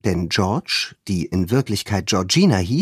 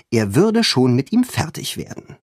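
plain reading voice

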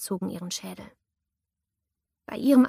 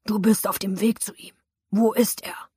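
menacing thug; 4 dB louder than usual. Acted. Attacker presses his victim.

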